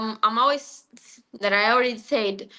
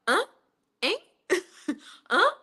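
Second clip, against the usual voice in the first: confused huh; 7 semitones higher than usual. Retold or imitated. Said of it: "I was always like".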